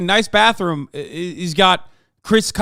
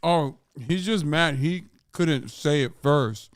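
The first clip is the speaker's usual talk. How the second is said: ordinary talk, nothing marked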